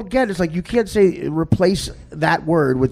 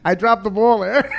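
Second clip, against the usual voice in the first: higher-pitched mock admission through laughter; laughing imitation; 8 semitones higher than usual. Comic repeats the shaken host's admission, laughing mimicry. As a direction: mimic the host while cracking up.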